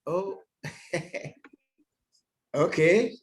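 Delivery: ordinary delivery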